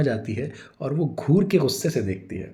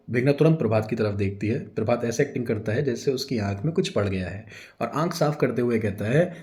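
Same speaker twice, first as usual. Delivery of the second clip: ordinary delivery, nothing marked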